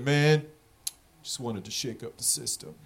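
very soft, low excuse; mock sheepish mumble. Dispensary manager explaining his vote, soft mock mumble. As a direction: let it fall away into a quiet, low mumble. Voices Trump-voting dispensary manager's lame answer.

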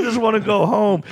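high-pitched tearful whine, put on; mock crying. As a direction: plead like a weepy drunk, exaggerated. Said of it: "and I just start crying".

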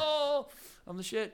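mock smug self-praise; smug boast. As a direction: gloat over your win.

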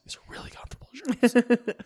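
high sheepish whisper, unvoiced. Real, not acted. Whispering to his co-host that the shirt is comfy. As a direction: confess it in a whisper with a grin.

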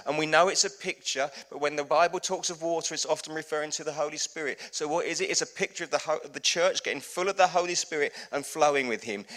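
ordinary talk

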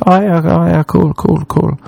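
high, repetitive polite nodding-along; awkward agreement. Retold or imitated. Irish people chatting awkwardly in Hollywood, high noncommittal agreement.

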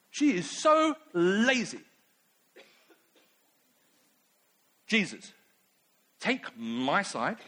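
lightly voiced indignant complaint; mildly huffy tattling on her sister; Martha grumbling. Martha asks Jesus to side with her, a touch indignant. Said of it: "Martha complaining about Mary".

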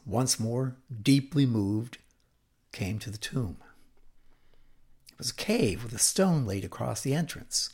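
ordinary delivery

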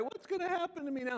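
high, quick self-pitying whine; whiny. Acted. Elijah crying out to God while hiding from Jezebel, whiny. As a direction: whine it like a helpless complainer. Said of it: "he has this kind of whiny voice".

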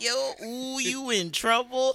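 loud, high, drawn-out teasing; gleeful warning. Retold or imitated. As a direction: stretch it out like a schoolyard taunt. Acting out teasing reaction to hearing.

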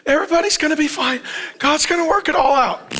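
mock optimistic. Pastor mimicking optimistic churchgoers who say all will be fine, mock optimistic. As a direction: mimic a naive, carefree optimist. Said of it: "some of you are very optimistic".

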